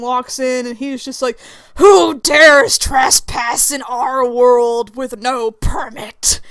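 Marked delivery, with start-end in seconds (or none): 1.7-6.4 loud, drawn-out villain's demand; booming challenge. Acted. Newcomer slams the doors open on trespassers, booming challenge. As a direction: thunder it slowly like an intruding overlord.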